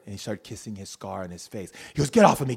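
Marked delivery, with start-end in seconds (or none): 2.1-2.6 loud, forceful get off of me; angry shout. Retold or imitated. Attacker yelling at mom to back off, loud. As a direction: shove her off with your voice.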